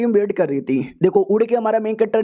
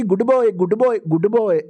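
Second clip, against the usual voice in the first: put-on praising a small child. A narrator's reading. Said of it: "छोटा बच्चा नहीं हूँ मैं जो ऐसे गुड बॉय".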